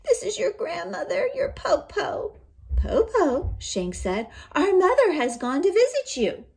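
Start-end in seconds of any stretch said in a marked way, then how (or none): 0.0-2.4 put-on much higher sweet old-woman voice; wolf as grandma. Disguised wolf coaxing the children at the door, high and sweet. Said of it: "the cunning wolf said". 2.8-3.6 put-on much higher, louder child's call. Eldest child asking through the latched door, high and loud. 4.5-6.4 put-on much higher, louder child's call. Eldest child asking through the latched door, high and loud.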